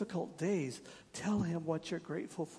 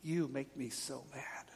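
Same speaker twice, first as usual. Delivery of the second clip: slow, hushed whisper of blame; slow whispered grumble; whispered complaint; 3 semitones lower, 4 dB quieter than usual. Retold or imitated. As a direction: breathe it out like a sulky accusation. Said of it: "We use phrases like".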